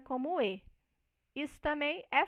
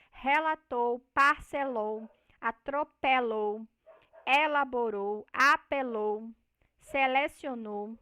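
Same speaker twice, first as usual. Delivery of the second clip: relatou, parcelou, atropelou said with an open e as in the Brazilian Northeast, a bit louder and slower; Northeastern open e; 5 dB louder, slower than usual. Shown as a demonstration. Teacher demonstrating the Northeastern open e to the students. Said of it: "no dialeto do Nordeste, nós vamos ter essas vogais abertas".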